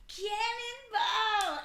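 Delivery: high, loud, drawn-out mock yell; retold drunken scream. Drunk wife leaping into the pool, imitated yell. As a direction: bellow it long like a pool jumper. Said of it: "screaming this out loud".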